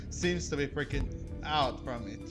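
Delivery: ordinary delivery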